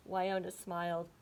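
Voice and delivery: ordinary delivery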